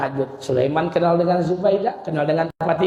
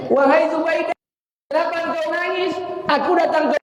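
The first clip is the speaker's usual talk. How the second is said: high pleading voice. Acted. Sulaiman asks Zubaidah why she cries.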